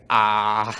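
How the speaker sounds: long, low drawn-out "ah" before answering; mock stalling, dropped low and held; hesitant. Boyfriend asked if he will leave, stalling hesitantly.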